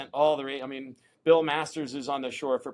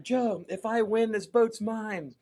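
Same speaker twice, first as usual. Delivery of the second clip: higher and slow, pumped-up bargaining; eager plea; 7 semitones higher, slower than usual. Retold or imitated. Paddler calling to the owner before his ride, high eager plea. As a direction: stake your claim, wide-eyed and keyed up. Bargaining for the boat mid-final.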